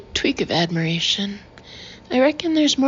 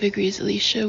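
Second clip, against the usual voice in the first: usual speaking voice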